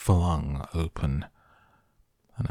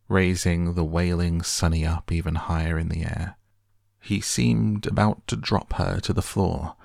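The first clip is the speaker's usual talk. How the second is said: ordinary delivery, nothing marked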